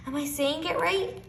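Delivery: teacher hoping she got it right; hopeful. A narrator's reading. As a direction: ask it with swelling hope. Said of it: "Her voice swelled with hope".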